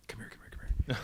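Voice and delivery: urgent hushed whisper. Retold or imitated. Producer calling him aside in a whisper. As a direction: beckon him over in a secret whisper.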